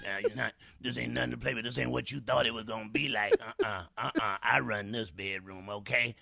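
low, bossy mock dominatrix. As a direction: play the woman who runs the bedroom, low and firm. Acting dominating woman in bedroom.

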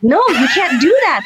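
mildly exasperated mock scolding; scandalized protest. Objecting to auntie's cheating advice, scandalized.

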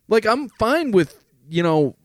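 ordinary delivery

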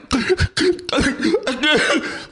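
high, gasping mock crying fit; imitated hyperventilating sobs. Actor shows host how they couldn't get their breath, mock hyperventilating. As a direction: gulp for air in short high sobs. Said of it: "I can't get my breath. I'm like".

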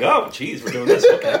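high, laughing mock exasperation. Real, not acted. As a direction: laugh through the quote.

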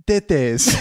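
imitating a French voice saying "Tetes"; "Tetes" said with a French accent. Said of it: "it says it in a French accent".

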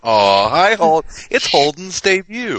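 high, slow cooing welcome. Real, not acted. Greeting the baby heard on mic.

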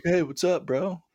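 high, chummy mock bro voice; bro greeting. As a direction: greet him like an old pal. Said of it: "the guy was like".

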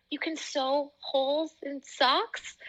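lightly voiced boyfriend in disbelief; imitating her boyfriend's amazement that socks can be mended; amazed boyfriend. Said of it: "He's like".

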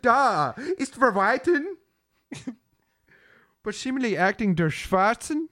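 mock German. Comic playing baffled German people, mock German accent. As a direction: put on a confused, high German voice. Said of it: "German people don't understand".

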